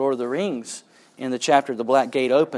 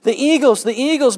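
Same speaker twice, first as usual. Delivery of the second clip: much higher, louder ecstatic cry; joyful cry; 11 semitones higher, 7 dB louder than usual. Acted. Bilbo dancing and waving at the eagles, ecstatic cry. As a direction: cry out in pure relief. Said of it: "Bilbo cried".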